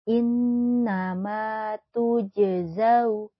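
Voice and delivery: slow chanted recitation; Quran recitation. Shown as a demonstration. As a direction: recite it slowly with tajwid. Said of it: "recitation demo".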